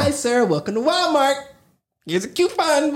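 high, bright mock greeter welcome; cheery Walmart greeter. Imitating a Walmart greeter welcoming a shopper, high and cheery. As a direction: play the overly happy store greeter. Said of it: "But I love it".

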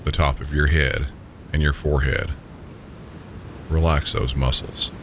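ordinary delivery

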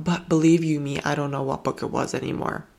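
usual speaking voice

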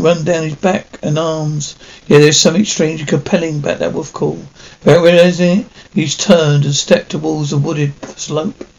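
usual speaking voice

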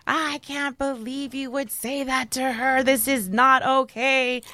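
imitated outraged mother, high and drawn out; mom losing it. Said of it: "my mom lost her shit".

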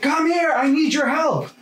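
dad calling for help from outside. Retold or imitated. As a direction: shout it over like a dad needing a hand.

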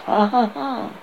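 put-on jeering laugh; guards' mock laugh. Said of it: "French gods".

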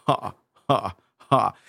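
low, cool spaced-out chuckle; deadpan low "ha", one at a time; Danny Zuko laugh. Shown as a demonstration. Brother practising a Grease laugh for his sister, low and spaced. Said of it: "a Danny Zuko from Grease laugh".

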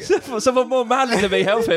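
teasing, high-pitched put-on voice; mock helpless plea. Teasing the storyteller as unmanly bystander, mock high plea. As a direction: pretend feeble cry for rescue.

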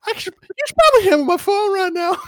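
loud, very high squeak; high-pitched frantic pleading. Acted. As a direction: insist in a squeaky, strained high voice. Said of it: "my voice is getting higher".

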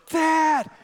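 high, loud squeal imitating a child greeting Dad; excited kids. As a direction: shout it like an ecstatic toddler. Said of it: "They are so excited".

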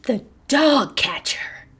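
high, slow, breath-filled gasp of dismay; horrified cry. A narrator's reading. Raggedy hearing of Fido's capture, horrified cry. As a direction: draw it out high in horror. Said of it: "cried Raggedy Ann".

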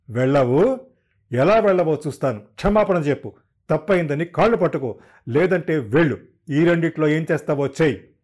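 loud, furious ultimatum; enraged threats. A narrator's reading. Husband after slapping his wife, demanding an apology in a loud rage. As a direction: bellow the ultimatum right after the slap.

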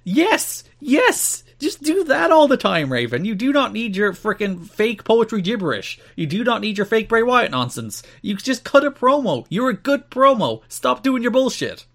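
high, emphatic, pleading rant; worked-up fan's impassioned plea; exasperated approval. Acted. Acted plea to the wrestler.

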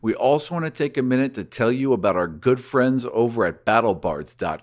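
loud, upbeat announcer read; podcast outro read. Acted. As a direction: sell the sponsor with energy.

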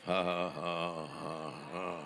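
slow, deliberate "ha, ha, ha"; fake laugh. Shown as a demonstration. Preacher showing a congregation courtesy laughing, fake slow laugh. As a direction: force out a spaced-out courtesy laugh. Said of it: "even if it's a fake laugh".

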